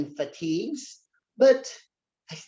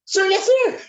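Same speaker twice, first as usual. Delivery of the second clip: very high, louder squeaky sir yes sir; high-pitched voice; 13 semitones higher, 7 dB louder than usual. Shown as a demonstration. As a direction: squeak the reply to the major. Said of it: "say it just like that".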